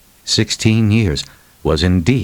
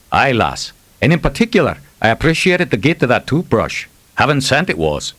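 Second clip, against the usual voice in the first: light Scottish burr, higher and playful; 7 semitones higher than usual. Acted. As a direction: put on a teasing Scottish brogue. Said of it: "slipping back into Macduff's burr".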